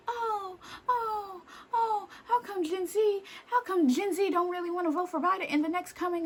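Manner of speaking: high-pitched whiny mock complaint; mocking talk-show host. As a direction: mimic clueless older panelists, pitched well up. Mocking The View's complaints about Gen Z.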